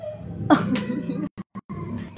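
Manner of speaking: soft breathy giggle; real hushed laugh; breathless laugh. Real, not acted. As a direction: laugh quietly behind the prank. Laughing at the prank.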